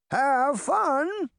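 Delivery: put-on very high old woman's call. A narrator's reading. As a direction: sing out after departing children. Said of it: "she called".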